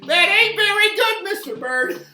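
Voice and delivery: high laughing squeal, loud; giggling teasing squeal. Real, not acted. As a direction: laugh out the words, delighted. Laughing at bird's bad solo.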